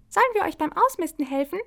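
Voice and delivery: high, fast goblin voice; eager goblin lady. A narrator's reading. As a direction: rattle it off eagerly. Kleine Koboldin bietet Hilfe an. (hohe, schnelle Koboldinnenstimme)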